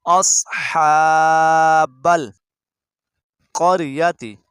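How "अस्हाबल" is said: अस्हाबल with a full, heavy स्वाद, not सीन or सा; अस्हाबल drawn out, the स्वाद heavy and emphatic; heavy saad in ashab. Shown as a demonstration. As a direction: stretch अस्हाबल slowly, hitting the emphatic स्वाद. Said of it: "स्वाद पढ़ना।".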